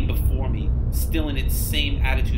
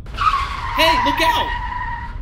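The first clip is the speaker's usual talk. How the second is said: alarmed warning shout; 12 semitones higher, 4 dB louder, much slower than usual. Acted. Driver warns of man on road.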